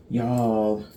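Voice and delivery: usual speaking voice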